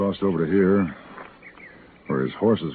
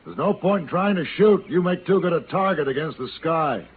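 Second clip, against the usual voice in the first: raised, higher call up into the tree; 8 semitones higher than usual. Acted. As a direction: project the voice up to the branches. Calls up to man in tree.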